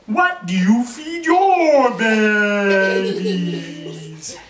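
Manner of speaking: high, loud and slow, mouth stretched wide; the biggest, widest frog voice yet; the frog asking the snake, wide-mouthed, high and loud. A narrator's reading. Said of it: "that's the way they talked".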